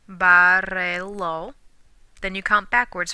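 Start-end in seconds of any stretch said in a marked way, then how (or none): 0.1-1.5 "Barrelo" said very slowly, stress on the first syllable ba; "Barrelo" drawn out, BA-rre-lo; slow model word. Shown as a demonstration. Teacher slowly models "Barrelo" for the class. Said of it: "take a look at what it's changed to".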